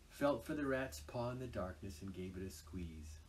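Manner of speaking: plain reading voice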